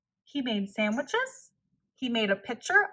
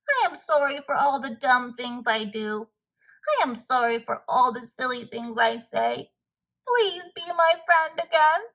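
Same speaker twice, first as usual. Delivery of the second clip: tearful apology. Acted. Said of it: "cried Toad".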